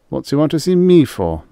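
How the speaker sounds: nervous and uneasy; worried question. Acted. Rye frets to Lin, high and worried. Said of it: "worried and unhappy".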